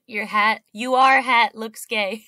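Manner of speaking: jeering, half laughing; mock taunt. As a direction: needle them with a silly insult. Acting out the heckler's scripted insult.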